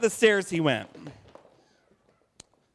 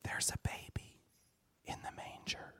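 hushed whisper, much softer; 18 dB quieter than usual. A narrator's reading. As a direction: whisper so the baby stays asleep. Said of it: "whispered everyone".

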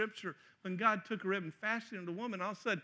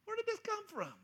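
amazed wonder. Retold or imitated. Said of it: "I'm like".

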